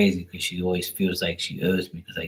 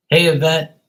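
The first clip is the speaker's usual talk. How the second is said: higher, louder call across the room; 6 semitones higher, 6 dB louder than usual. Real, not acted. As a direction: call out a friendly greeting. Calling out to someone.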